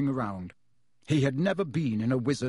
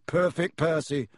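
plain reading voice

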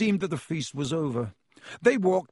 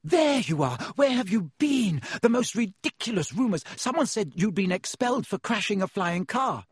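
higher-pitched, urgent questioning; agitated scolding; 6 semitones higher than usual. A narrator's reading. Girl confronts friends over wild rumours, agitated scolding. Hermione dashes up, scolding the boys.

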